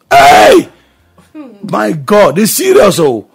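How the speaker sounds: loud, high shocked scolding; outraged rebuke. Acted. Preacher outraged at a churchgoer judging God, loud rebuke. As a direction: erupt in outrage at the insult.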